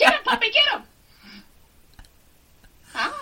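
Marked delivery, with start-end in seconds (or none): 0.0-0.8 high, loud cheering; excited cheer. Pretend. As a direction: cheer it on like a dog. Cheering on blown-up condom.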